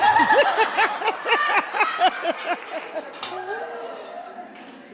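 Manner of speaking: long high laugh; hearty laugh. Real, not acted. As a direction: laugh long at your own joke. Laughing after punchline.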